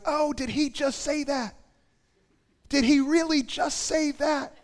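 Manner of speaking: high-pitched, incredulous reaction; astonished disbelief. Acted. Readers reacting to Jesus' huge claims, high and incredulous.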